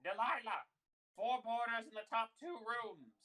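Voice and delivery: high, hollered summons; yelling. Acted. Innkeeper yells to Delilah about new boarders, hollering. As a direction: holler across the inn as an old landlady. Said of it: "you just hear them yell".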